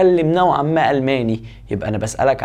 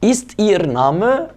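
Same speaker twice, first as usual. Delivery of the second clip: slow dictation; 5 semitones higher, much slower than usual. Shown as a demonstration. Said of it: "slow model of the question".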